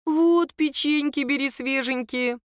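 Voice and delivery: high, eager hostess voice, lightly voiced; fussing mother-in-law. A narrator's reading. Fussing mother-in-law offering biscuits.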